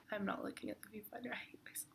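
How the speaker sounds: unvoiced muttered whisper to herself; whispered aside. Real, not acted. Vlogger fumbling the camera, whispering to herself. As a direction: whisper it under your breath.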